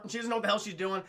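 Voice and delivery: ordinary delivery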